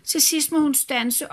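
ordinary delivery, nothing marked

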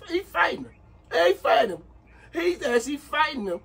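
high, incredulous, half laughing; can't believe what he's seeing; stunned laugh at the fight; amazed disbelief. Real, not acted.

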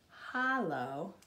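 ordinary delivery, nothing marked

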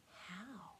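hushed, soft wonder. Acted. Ducks amazed that papa survived, wondering softly. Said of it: "the other ducks wondered".

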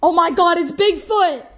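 loud, high shriek of alarm; shocked shout. Acted. Waiter spotting Bigfoot at the table, shrieking in shock. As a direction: scream it like you've seen a monster.

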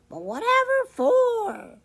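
very high, loud hen voice; storybook hen, high and clucky; clucking hen. A narrator's reading. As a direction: squawk the question like a chicken. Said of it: "she clucked".

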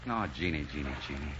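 lost in love for his wife; tender murmur. Acted. Husband murmurs wife's name lovingly.

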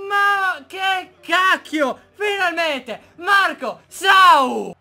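loud, slow shout of disbelief and joy; excited goal celebration. Real, not acted.

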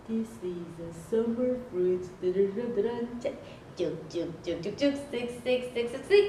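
hushed. Acted. Hushed play with watermelon.

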